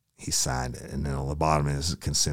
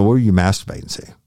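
pressing shout; 2 semitones higher, 8 dB louder than usual. Retold or imitated. Officer grilling the suspect, pressing shout. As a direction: press him hard, rapid fire.

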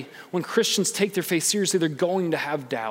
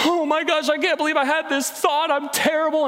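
mock panic; 10 semitones higher than usual. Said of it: "feel so guilty about it".

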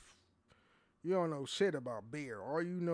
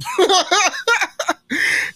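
loud, high burst of laughter; real laugh; 20 dB louder than usual. Real, not acted. Podcaster breaking up mid-ad, laughing. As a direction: crack up loudly at your own fumble. Cracks up at own ad fumble.

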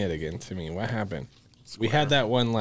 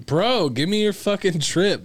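pleading demand; 9 semitones higher, 3 dB louder, slower than usual. Retold or imitated. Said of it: "I was like".

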